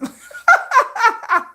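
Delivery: loud, high peal of laughter; real squealing cackle. Real, not acted. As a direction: burst out laughing. Laughs after sweet quote.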